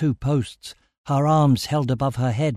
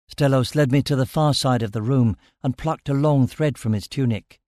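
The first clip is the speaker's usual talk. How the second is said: ordinary delivery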